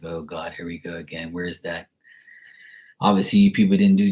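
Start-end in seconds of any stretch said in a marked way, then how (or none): 0.0-1.9 low, very soft aside; weary exasperation. Retold or imitated. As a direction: grumble it under your breath, resigned. Weary aside at repeated question.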